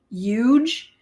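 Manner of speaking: "huge" drawn out with the h dropped: yuge; Trump's "yuge". Retold or imitated. Teasing dad about Trump, "huge" said as "yuge". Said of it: "Donald Trump says huge, not huge".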